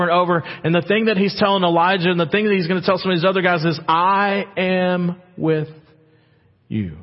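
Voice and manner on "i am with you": slow, drawn-out promise. Acted.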